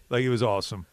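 ordinary talk, nothing marked

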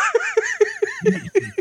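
high burst of real laughter. Real, not acted. Laughing at being called a gimmick stealer, high laugh.

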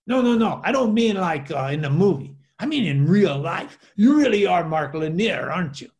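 loud, insistent imitation of a Spanish accent; thick Spanish accent. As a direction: press the question in a put-on Spanish accent. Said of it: "with a really thick, uh, Spanish accent".

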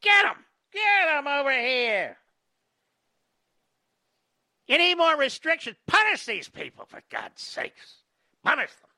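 high shrieking lynch-mob cries; mob member. Retold or imitated. Host casting the reporter as a jailhouse mob, shrill and high. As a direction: cry for punishment like a frenzied western mob. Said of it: "She'd be one of the mob".

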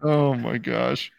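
slow, breathless laugh; cracking up over a joke. Real, not acted.

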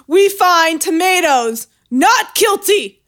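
high, slow laughing proclamation; town crier. Retold or imitated. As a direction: announce the verdict grandly.

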